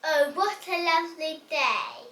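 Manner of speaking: normal talking voice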